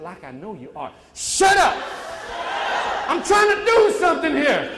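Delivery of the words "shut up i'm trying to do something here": loud, high defiant shout; booming crowd-style shut up; confident shout. Shown as a demonstration. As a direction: shout it like you're top ten percent. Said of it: "say it like you're in the top ten percent".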